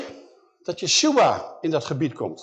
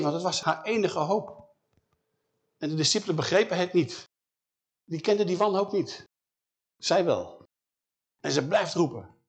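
usual speaking voice

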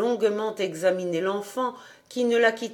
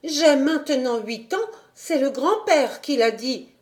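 put-on higher, bright little girl's voice; interrupting child; 6 semitones higher than usual. A narrator's reading. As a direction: chime in innocently. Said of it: "interrompit Heidi".

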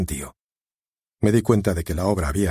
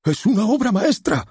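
high, ecstatic cry of praise; ecstatic outburst; 3 dB louder than usual. Acted. An enraptured spectator crying out praise, ecstatic and high. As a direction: gush it out, carried away by the play. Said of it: "una voz extasiada dijo".

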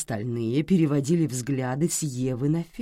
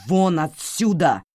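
slow, much louder shout; 8 dB louder, slower than usual. Acted. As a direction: roar it out in fury. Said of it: "Таким взбешённым его никто ещё не видел".

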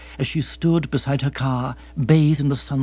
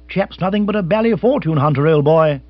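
clubman bluster; 2 semitones higher, 4 dB louder than usual. Retold or imitated. Club men denouncing a fortune hunter, loud bluster. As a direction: scoff like a stuffy club member. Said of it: "they would say".

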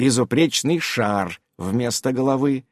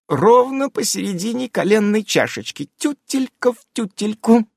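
fawning; 10 semitones higher than usual. A narrator's reading. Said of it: "заискивающе пояснила она".

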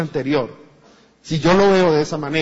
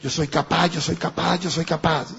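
mock self-affirmation; 3 semitones lower, 4 dB quieter than usual. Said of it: "Hay mucha gente que dice".

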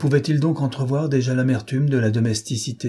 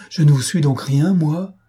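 high, loud hurt protest; jealous heartbroken outburst; wounded cry; 5 semitones higher, 5 dB louder than usual. A narrator's reading. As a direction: cry out your own claim on him. Finette hurt by his confession.

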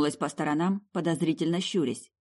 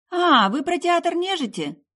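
high, drawn-out merry realisation; merry girl's voice, pitched high; cheerful girl; 13 semitones higher, 5 dB louder, slower than usual. Acted. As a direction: brighten up with sudden cheer. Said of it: "Веселье вернулось в голос странной девицы".

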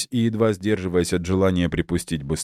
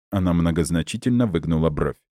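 ordinary delivery, nothing marked

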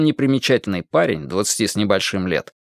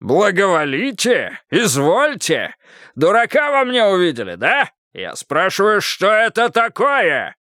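furious yelling; 8 semitones higher, slower than usual. Acted. Karpov shouts at Oleg.

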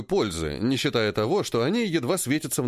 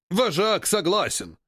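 ape leader voice; 6 semitones higher, slower than usual. Acted. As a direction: boom it out like an ape. (медленный голос вожака)

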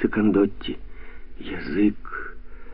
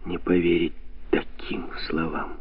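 usual speaking voice

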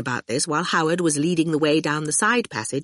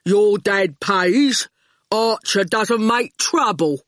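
slow, heavy-witted thug, words dropped one by one; menacing henchman taking his time; slow lumbering thug; slow goon voice; 3 semitones higher, slower than usual. Acted.

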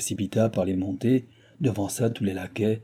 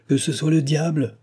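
raised, vehement exclamation; higher-pitched outburst; exclaiming; 6 semitones higher, 4 dB louder than usual. A narrator's reading. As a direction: cry it out with defiance. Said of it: "s'écria madame du Barry".

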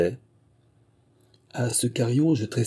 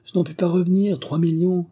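high-pitched incredulous exclamation; astonished, unable to take it in; amazed; 10 semitones higher, 3 dB louder than usual. A narrator's reading. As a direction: marvel at it with voice lifted throughout. Dufay amazed at the capture.

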